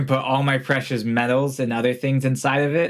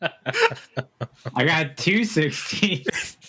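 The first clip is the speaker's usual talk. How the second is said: laughing; 4 semitones higher, much slower than usual. Real, not acted. Laughing at roll.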